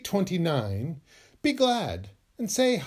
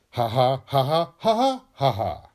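louder, pushy fake laugh; spoken ha ha forced out louder and louder; forced laugh building; 3 semitones lower, 4 dB louder than usual. Shown as a demonstration. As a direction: crank a fake laugh up like an engine. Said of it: "Or, if you must".

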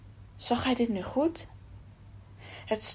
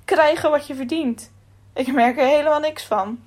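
mocking the slogan, louder and higher; sarcastic; 5 semitones higher, 6 dB louder than usual. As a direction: throw the words back with scorn. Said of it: "lachte Akos sarcastisch".

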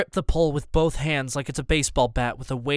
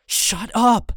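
high, loud frantic shout; wild shout; 8 semitones higher, 5 dB louder than usual. A narrator's reading. Boy yells wildly at his stunned family. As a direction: yell it like a wild man. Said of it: "wave my arm like a wild man".

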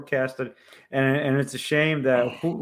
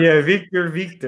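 put-on co-host echoes Chekhov's thick Russian accent, a bit louder; 4 semitones higher, 6 dB louder than usual. Co-host echoing Chekhov to the computer, Russian accent. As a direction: chime in with a mock Russian accent. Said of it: "that thick Russian accent".

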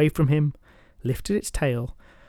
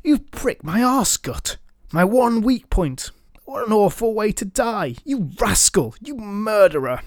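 anguished dragon cry; 7 semitones higher than usual. Acted. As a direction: cry out in dying agony. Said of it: "crying out".

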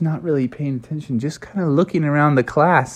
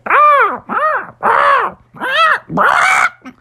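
high, loud bird squawks climbing higher; squawking birds; 4 dB louder, much slower than usual. Acted. Birds in the class squawk back at Baby Yoda, high and loud. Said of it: "The birds in the class were squawking".

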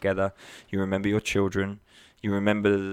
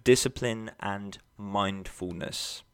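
discipline and mindfulness drawn out, dropping quiet and slow; slow soft emphasis; 7 dB quieter, slower than usual. Shown as a demonstration. Coach closing his list, slow and soft. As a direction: lean on discipline, then let it fade softly. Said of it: "summing up the rule".

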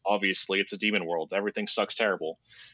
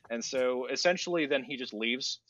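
ordinary delivery

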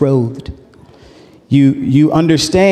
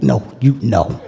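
mock refusal; 5 semitones lower, 6 dB quieter than usual. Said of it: "It's like a dance move".

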